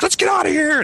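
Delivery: high-pitched frantic call; urgent getaway. Retold or imitated. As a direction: retell rallying everyone to flee.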